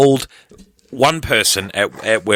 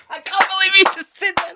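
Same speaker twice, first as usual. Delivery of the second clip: laughing. Real, not acted. Laughs at slip on air.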